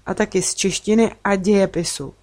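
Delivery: ordinary delivery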